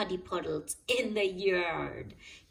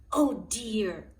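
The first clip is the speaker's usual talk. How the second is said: startled gasp; 5 dB louder than usual. A narrator's reading. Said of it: "gasped Grandpa Pig".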